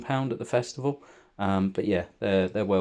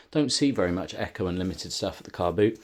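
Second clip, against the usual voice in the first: normal talking voice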